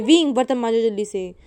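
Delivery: loud bossy call from the aunt; aunt shouting. Acted. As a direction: yell the order across the house. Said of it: "aunty चिल्लाती हैं". (Aunty wing को बर्तन के लिए ज़ोर से पुकारती हैं)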